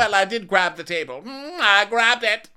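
high, loud hammed-up Karen voice; mock Karen. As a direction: squeal it like Karen defending herself. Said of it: "And Karen's like".

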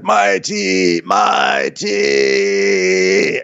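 drawn-out comic wail for a drink; lightly voiced needy old king calling out; mock demanding king. Voicing ailing king demanding tea.

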